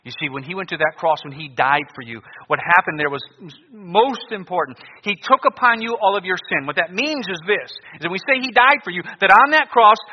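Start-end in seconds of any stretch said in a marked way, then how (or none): 5.0-10.0 high, loud fire-and-brimstone preaching; doomsday street preacher. Acted. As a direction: thunder out the warning of judgment.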